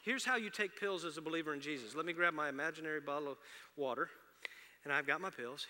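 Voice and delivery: ordinary talk, nothing marked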